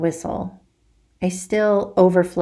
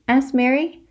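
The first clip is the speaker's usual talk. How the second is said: ordinary delivery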